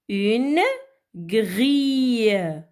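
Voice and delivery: Une, pause, long grille; syllable by syllable. Shown as a demonstration. Teacher helping children read, Une - grille in separate beats. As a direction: isolate Une and stretch grille. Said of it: "découper les mots en syllabes".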